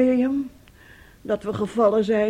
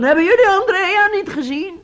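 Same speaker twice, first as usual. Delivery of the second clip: higher, louder, distraught stranger's voice; desperate plea; 9 semitones higher, 7 dB louder than usual. Acted. Aunt voicing the boy desperately asking strangers about Andrea. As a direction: beg the strangers, voice cracking upward. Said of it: "En hij vraagt dan".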